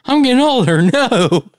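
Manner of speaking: mock dismay.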